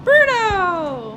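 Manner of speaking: loud, high, drawn-out shout of the name; calling out. Real, not acted. Player calling Bruno away mid-game, loud shout. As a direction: holler the name, stretching the end.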